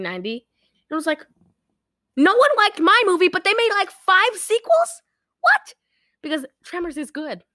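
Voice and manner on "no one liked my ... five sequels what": high, loud mock outrage; hammed-up disbelief, rising to a squeal; acted indignation. Writer jealous of Tremors sequels, loud high mock indignation.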